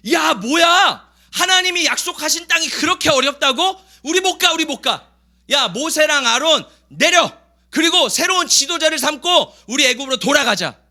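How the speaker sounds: loud, high-pitched mock revolt; indignant complaint. Israelites grumbling against Moses and Aaron, acted as indignant protest. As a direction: act out an outraged crowd refusing to go on.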